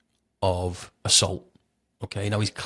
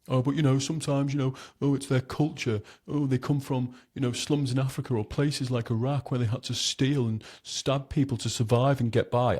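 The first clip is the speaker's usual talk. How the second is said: higher-pitched mock excuse-making; mimicked apologist, pitched up; mocking imitation; 5 semitones higher than usual. Mocks excuses others make for them.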